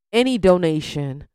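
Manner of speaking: usual speaking voice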